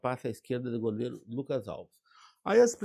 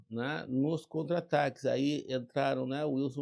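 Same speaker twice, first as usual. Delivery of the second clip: ordinary talk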